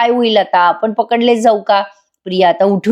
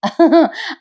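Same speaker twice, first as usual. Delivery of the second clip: short high giggle; a quick shared laugh after the teasing; light giggle; 8 semitones higher than usual. Retold or imitated. Couple laughing together.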